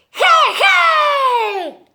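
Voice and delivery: high, loud squeaky shout. Acted. Piglet shouting into the dog's ear, high and loud. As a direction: yell it sharp and high. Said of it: "gritó en la oreja del perro".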